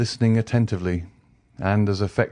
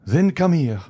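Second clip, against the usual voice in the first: much higher, louder urgent order; frantic command; 8 semitones higher, 5 dB louder than usual. A narrator's reading. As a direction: pull him along in a frenzy. Villefort drags the Count away.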